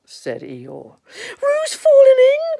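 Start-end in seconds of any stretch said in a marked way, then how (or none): 1.4-2.6 high, drawn-out shout; cried alarm. Acted. Rabbit raising the alarm as Roo falls in, high alarmed cry. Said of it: "cried Rabbit".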